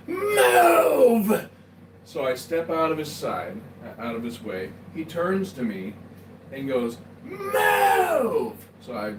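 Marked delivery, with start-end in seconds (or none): 0.1-1.5 high, drawn-out angry bellow; furious yell. Acted. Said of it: "So he yells". 7.2-8.5 high, drawn-out angry bellow; furious yell. Acted. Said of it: "So he yells".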